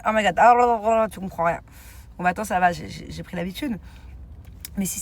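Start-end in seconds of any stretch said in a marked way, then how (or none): 0.1-1.1 lightly mocked English accent, louder jumble. Friend mocking her English friends' incomprehensible speech, mock English accent. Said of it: "Mais l'accent anglais".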